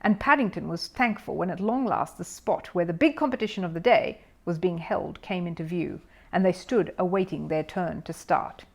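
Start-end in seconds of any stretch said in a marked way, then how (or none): none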